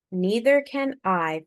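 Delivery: ordinary delivery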